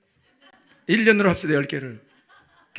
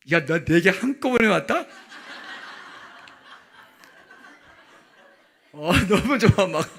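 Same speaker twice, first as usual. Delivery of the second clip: laughing boast; 2 semitones higher, 4 dB louder than usual. Acted. Acted joyful boast, laughing.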